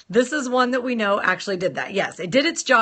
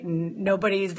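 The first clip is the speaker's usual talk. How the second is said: normal talking voice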